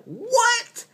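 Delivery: very high, mocking disbelief; incredulous what. Doctor to the attending about the dog, shrill incredulous squeak. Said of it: "it's like".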